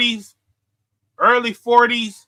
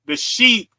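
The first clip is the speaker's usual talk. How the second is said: ordinary delivery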